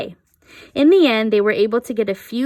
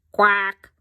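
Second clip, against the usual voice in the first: duck quack; 4 dB louder than usual. A narrator's reading. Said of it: "said a voice".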